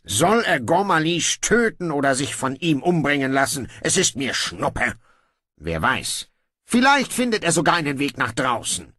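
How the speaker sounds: a lazy king giving in; offhand young king. Acted. Brocando grudgingly orders guards to release his brother, breezy.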